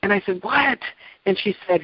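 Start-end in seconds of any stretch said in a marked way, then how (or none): 0.4-0.8 a sharp, much higher "What?" of alarm; startled what. Retold or imitated. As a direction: jump up in pitch, caught off guard. Startled question to weeping friend.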